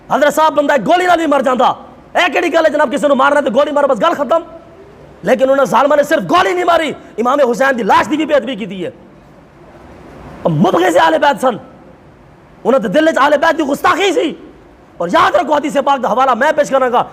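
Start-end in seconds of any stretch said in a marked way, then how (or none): none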